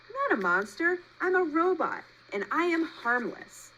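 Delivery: calming a scared animal; reassuring robot. A narrator's reading. Roz assures the frightened possum, even robot voice.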